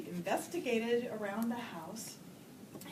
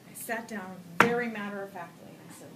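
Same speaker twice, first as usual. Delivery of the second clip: normal talking voice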